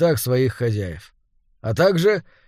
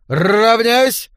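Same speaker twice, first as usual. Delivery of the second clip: shouting drill command; 7 semitones higher, 5 dB louder than usual. A narrator's reading. Command repeated after Отставить.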